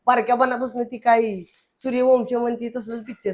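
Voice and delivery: ordinary talk, nothing marked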